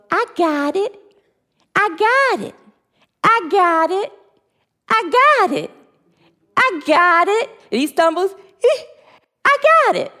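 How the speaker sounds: put-on a determined two-year-old insisting he's fine; toddler voice. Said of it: "And he goes".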